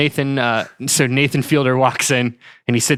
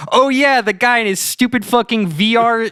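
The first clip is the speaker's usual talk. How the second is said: excited; 7 semitones higher than usual. Retold or imitated. Retells own reaction to game.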